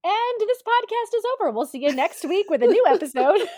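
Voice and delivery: high, loud fake outro; mock sign-off. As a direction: wrap it up like a radio host. Mock abrupt podcast sign-off.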